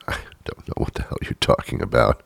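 fast, soft whisper under the breath; hushed snippy aside. Pretend. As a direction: mutter it aside so nobody hears.

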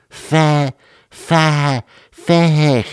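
high, stuck "fa-" over and over; failed pronunciation attempts. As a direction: struggle comically to get past "fa-". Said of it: "I can't pronounce it".

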